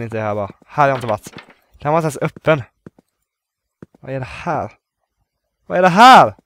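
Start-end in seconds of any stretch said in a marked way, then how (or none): 5.7-6.3 "Vad är det här?" louder and higher, jolted; startled exclamation. Real, not acted. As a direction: blurt it out startled. Startled loud repeat.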